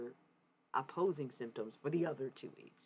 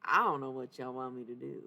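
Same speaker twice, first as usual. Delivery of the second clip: normal talking voice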